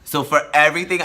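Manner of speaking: usual speaking voice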